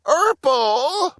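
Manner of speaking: high, whining call of his brother's name; a high, whiny Burple; whiny little brother. A narrator's reading. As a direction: whine it like a sulky kid. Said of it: "Burple whined".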